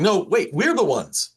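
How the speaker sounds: a hint of a group insisting they were first; mock indignant claim of being the chosen ones; indignant believers.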